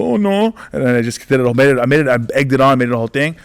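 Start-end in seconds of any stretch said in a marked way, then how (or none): 0.0-0.5 much higher-pitched teasing "oh no"; mock dismay. Comedian to man denying a crush, mock dismay. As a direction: tease with exaggerated dismay.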